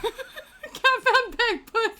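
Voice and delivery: high, slow laughing words; cracking up while reading it. Real, not acted. Laughing at a posted image.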